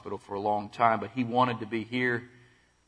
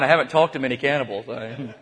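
usual speaking voice

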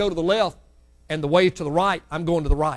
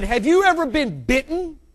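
stern father question; 9 semitones higher, 4 dB louder than usual. Retold or imitated. Father confronting biting child.

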